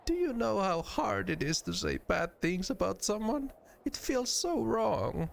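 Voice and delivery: high-pitched, plaintive innocent; sweet character, mildly despondent; dejected Nenny. Acted. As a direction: lament it in a high voice, drawing out the end.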